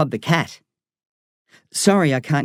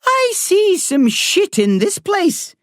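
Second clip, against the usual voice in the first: put-on lilting Irish accent, higher and slow; 3 dB louder, slower than usual. A narrator's reading. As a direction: say it in a gentle Irish lilt like a sunrise. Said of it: "her Irish accent making that sentence sound".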